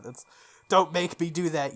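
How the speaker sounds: ordinary talk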